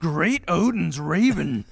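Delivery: loud, slow, grand oath; booming exclamation. Retold or imitated. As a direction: declare it like an epic oath. Quoting Anchorman exclamation.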